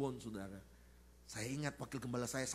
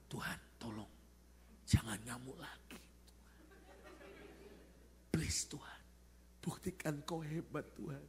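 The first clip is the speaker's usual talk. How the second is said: hushed, half-whispered prayer; silent plea to God; 5 dB quieter than usual. Retold or imitated. Pastor secretly begging God outside the door, hushed plea. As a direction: plead under your breath. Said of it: "Dalam hati saya".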